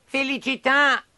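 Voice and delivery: high, slow puppet call; drawn-out blessing. Acted. Pinocchio answering further sneezes, drawn-out high blessing. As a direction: sing out the word eagerly.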